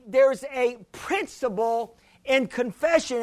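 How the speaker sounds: usual speaking voice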